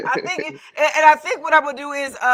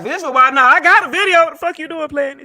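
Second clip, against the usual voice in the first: loud, raised retelling of a complaint; mock indignant protest; 5 semitones higher, 8 dB louder than usual. Co-host voicing the guest's gripe about visuals, loud mock indignation. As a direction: imitate someone protesting in playful outrage.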